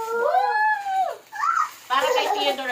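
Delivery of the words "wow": put-on high, drawn-out wow for the baby; exaggerated wow. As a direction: sell the surprise to the toddler. Mock amazement for toddler.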